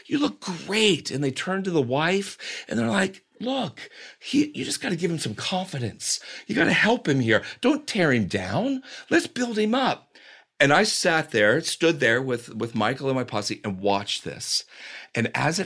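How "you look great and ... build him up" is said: high, hushed coaxing. Retold or imitated. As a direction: gently lecture the wife. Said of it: "they said".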